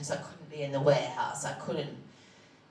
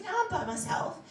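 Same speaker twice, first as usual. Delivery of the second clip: much higher, a thin small voice. Retold or imitated. Patient alone on the phone telling her doctor nobody's there, small high voice. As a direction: answer like a scared kid trying to sound okay.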